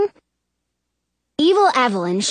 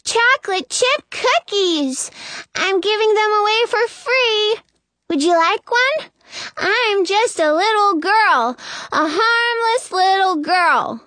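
put-on little girl calling; 8 semitones higher than usual. A narrator's reading. Disguised queen offers cookies from outside, calling out. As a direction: play the harmless child, pitched high. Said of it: "the girl calls from outside".

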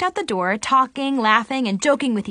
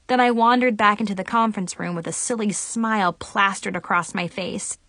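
plain reading voice

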